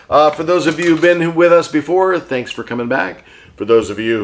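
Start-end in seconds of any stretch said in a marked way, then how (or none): none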